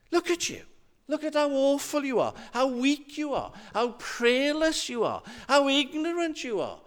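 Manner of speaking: high-pitched scolding in a repeated falling tune; accusing inner voice. Acted. Little voice on the shoulder listing faults, scornfully accusing. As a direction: pile up reproaches like a scornful little critic. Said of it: "and it says".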